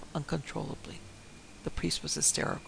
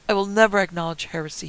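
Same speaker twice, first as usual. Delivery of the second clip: much louder, outraged refusal; defiant cry; 3 semitones higher, 9 dB louder than usual. A narrator's reading. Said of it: "he cried".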